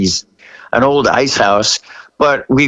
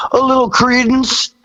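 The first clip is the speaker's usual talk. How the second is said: high deadpan reply; 11 semitones higher than usual. Retold or imitated. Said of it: "And he goes".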